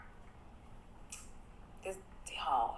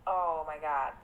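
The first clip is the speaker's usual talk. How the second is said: ordinary talk, nothing marked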